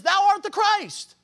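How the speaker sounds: high, loud and slow, as Peter declaring it; Peter's bold confession. Acted. Peter speaking up for the disciples, declaring it high and loud. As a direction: proclaim it like Peter speaking up for the group. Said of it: "He speaks up and he says".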